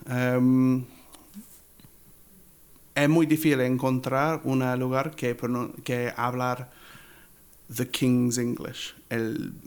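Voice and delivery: usual speaking voice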